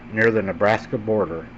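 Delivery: ordinary delivery, nothing marked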